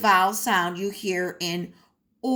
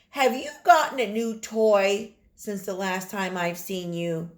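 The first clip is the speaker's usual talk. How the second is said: ordinary talk, nothing marked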